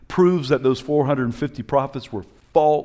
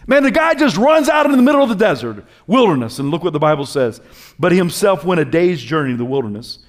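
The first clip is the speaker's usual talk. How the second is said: loud, high preacher's call; fiery preaching; 5 semitones higher, 9 dB louder than usual. Real, not acted. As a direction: stir them up. Preaching at full force.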